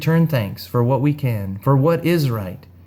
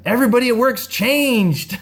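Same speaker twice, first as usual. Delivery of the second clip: much higher, eager woman's voice; excited woman; 10 semitones higher than usual. Retold or imitated. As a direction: gush at him, pitched way up. Excited woman reports change.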